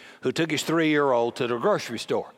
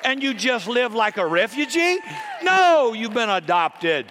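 preaching at full force; 7 semitones higher than usual. Acted. Preacher to congregation, preaching at full force. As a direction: press the promise home, building.

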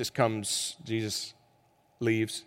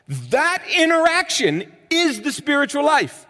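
preaching at full force; 14 semitones higher, 7 dB louder than usual. Acted. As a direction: hammer it home from the pulpit. Preaching climax.

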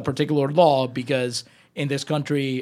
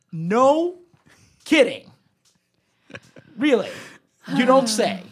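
pitched-up deadpan disbelief; sarcastic mock surprise; 9 semitones higher, 4 dB louder than usual. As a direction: feign astonishment at the obvious, word by word. Mock amazement at obvious statement.

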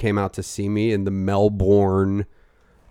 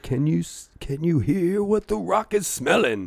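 higher, put-on wrestler voice; mock wrestling promo, higher; Rock impression; 8 semitones higher than usual. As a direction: ham up The Rock's catchphrase. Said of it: "He'd be like".

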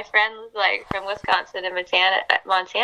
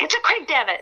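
higher-pitched mock exasperation; annoyed outburst; 8 semitones higher than usual. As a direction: snap in playful irritation at being nagged. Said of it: "gets on my nerves".

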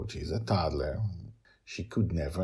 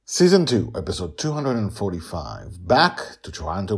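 announcer; 5 dB louder than usual. Acted. Announcing episode title.

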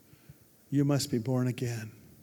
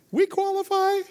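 very high, louder squeak; mock squeaky question; 5 dB louder than usual. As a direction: squeak it out in comic disbelief. Joking aside on being chosen dummies.

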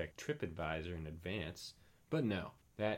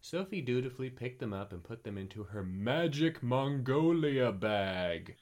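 usual speaking voice

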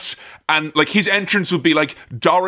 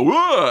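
high mock cry of alarm; startled whoa; 8 semitones higher than usual. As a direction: yelp as if caught out. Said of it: "controlling the show and go".

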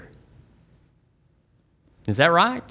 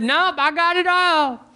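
high, slow cheery lady voice; the healed lady brushing off the minister, high and slow; cheerful old lady. Retold or imitated. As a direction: chirp it out, fully satisfied. Lady answers minister, satisfied.

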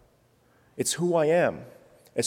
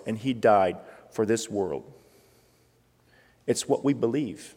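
normal talking voice

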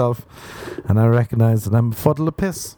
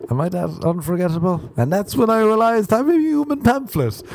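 laughing at himself; 10 semitones higher than usual. Real, not acted. Self-mocking laughter.

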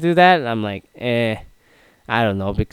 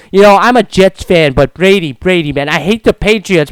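ordinary talk

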